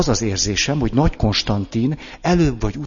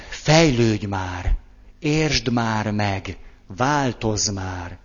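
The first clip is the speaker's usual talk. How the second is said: impatient demands; much slower than usual. Retold or imitated. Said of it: "hogy türelmetlen vagyok".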